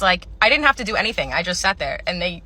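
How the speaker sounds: normal talking voice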